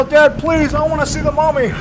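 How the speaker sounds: put-on high, begging little boy's voice; whiny kid pleading with dad; pleading child. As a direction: beg like a five-year-old on his knees. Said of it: "I go".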